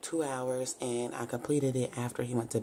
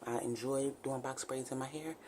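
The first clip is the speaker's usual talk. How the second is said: usual speaking voice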